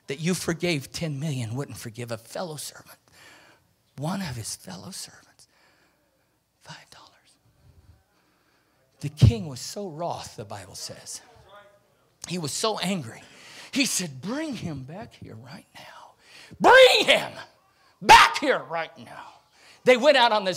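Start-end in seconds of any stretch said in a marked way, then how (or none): 0.1-7.1 hushed, unvoiced tattling; whispered report. Fellow servants tell the king in a secret whisper. 14.2-16.1 tense whisper. Acted. Angry king's order. 16.6-19.3 angry royal order. Acted. King orders the servant fetched.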